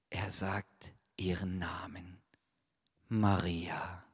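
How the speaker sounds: soft, low and tender. Acted. Jesus softly calls Maria by name. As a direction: say her name gently.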